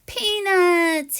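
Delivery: thrilled to see the gift; delighted squirrel. Acted. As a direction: squeal the name with joy. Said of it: "he says".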